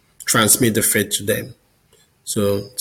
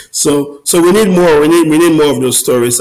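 louder, higher, pressing the point; emphatic; 5 semitones higher, 7 dB louder than usual. Real, not acted. Guest urges the host for more stories, louder and higher. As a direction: insist we need more of them.

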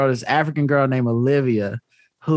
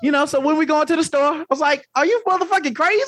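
high, heated retold outburst; mock-furious high rant; exasperated rant; 12 semitones higher than usual. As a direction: vent it like you're fed up. Retelling angry refusal to girl.

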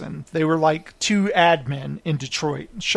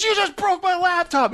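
very high mock outrage; hammed-up, pitched-up indignation; outraged contestant; 13 semitones higher than usual. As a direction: wail in protest as the wronged contestant.

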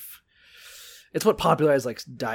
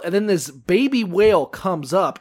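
normal talking voice

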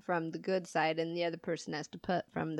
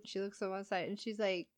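ordinary talk

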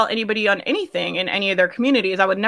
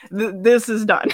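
laughing decree. Retold or imitated. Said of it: "I was like".

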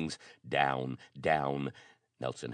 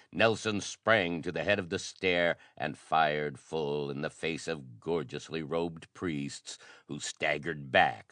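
usual speaking voice

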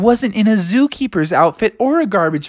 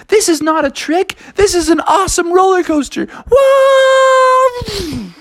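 roller coaster thrill. Acted. Animals riding the coaster, high thrilled whoa. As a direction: scream with joy on the drop.

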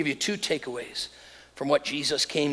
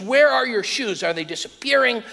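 higher-pitched, mock-incredulous interrogation; exasperated dad; 6 semitones higher, 4 dB louder than usual. Dad grilling his daughter about lost shoes, acted exasperation.

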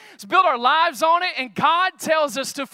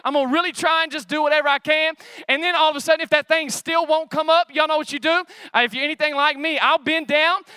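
ordinary talk

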